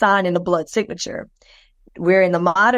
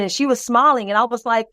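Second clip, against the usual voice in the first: ordinary delivery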